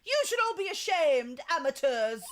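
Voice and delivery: put-on a high, light British accent scolding; a young British boy scolding the class; a hint of a British kid sneering; British child accent. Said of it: "How do I do a British accent with a child?".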